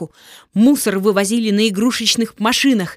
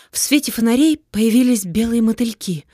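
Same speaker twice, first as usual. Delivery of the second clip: ordinary delivery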